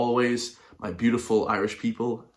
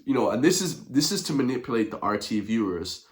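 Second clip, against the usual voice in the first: usual speaking voice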